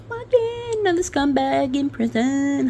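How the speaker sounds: high, gleeful sing-song; a mock-cheery high celebration; gleeful high squeal. Real, not acted. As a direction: squeal it with glee. Gleeful reaction to news.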